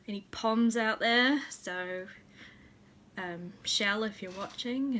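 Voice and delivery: ordinary talk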